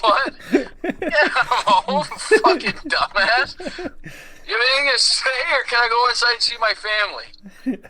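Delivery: high-pitched hammed-up insults; mock hostile outburst. Arriving home to someone with something to say, mock hostile. Acted angry homecoming tirade.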